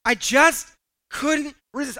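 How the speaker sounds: slow, playful mock confession; helpless confession. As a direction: stretch it out like someone caving in. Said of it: "ever heard someone say".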